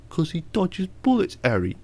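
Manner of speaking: quick, high mocking line read; mock gangster film line. Mocking Guy Ritchie dialogue to a friend, high and sneering. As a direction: send up a cheesy movie line. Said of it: "crappy lines like this".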